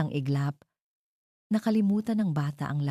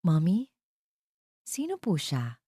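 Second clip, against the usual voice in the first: put-on child asking; 2 semitones higher than usual. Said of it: "tanong ni Leo".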